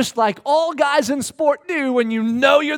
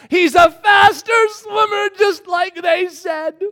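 high, loud, drawn-out mock wail; despairing wail; 8 semitones higher, 7 dB louder, slower than usual. Fans giving up on the race, loud despairing wail. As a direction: wail it slowly in exaggerated defeat.

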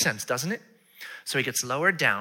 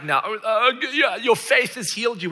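loud, high, pompous pronouncement; hammed-up preachy announcement; mock proclamation; 10 semitones higher, 8 dB louder than usual. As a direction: declare it showily, as a parody. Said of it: "So he's not like".

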